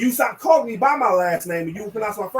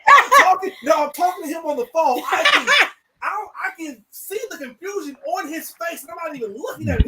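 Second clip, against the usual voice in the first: higher and louder, laughing; excited high storytelling; excited laughing retelling; 7 semitones higher, 5 dB louder than usual. Real, not acted. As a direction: rush it out amused. Telling the story through laughter.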